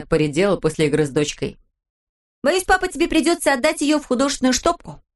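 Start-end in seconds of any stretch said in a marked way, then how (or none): 2.5-5.0 put-on high, bright little girl; lively young daughter; cheeky girl voice. A narrator's reading. Pippi voiced in story. (голос девочки)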